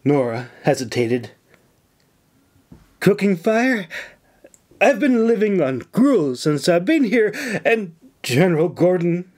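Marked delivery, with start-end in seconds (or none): 3.0-9.2 high, tearful put-on complaint; hammed-up blubbering. A narrator's reading. Nora blubbering fake woes to the governor. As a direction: blubber it, laying it on. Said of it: "she blubbered".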